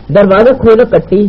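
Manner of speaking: calling out. Acted. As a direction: call through a closed door to be let in. Officer demands door be opened. (پکار کر، تحکمانہ انداز میں)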